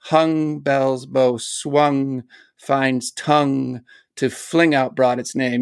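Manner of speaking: slow, tolling words like a big bell; slow gonging reading. Shown as a demonstration. Reading the poem aloud, tolling slowly like a bell. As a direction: toll each word like a church bell. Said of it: "makes the sound of it gonging back and forth".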